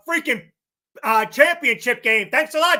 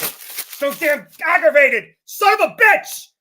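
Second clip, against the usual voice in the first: slow, louder, higher furious growl of frustration; aggravated outburst; 6 semitones higher, 6 dB louder, slower than usual. Real, not acted. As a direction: let the anger explode. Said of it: "I'm so aggravated".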